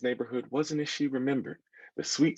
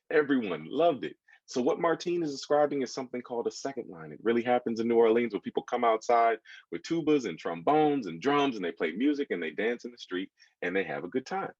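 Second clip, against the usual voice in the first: ordinary delivery, nothing marked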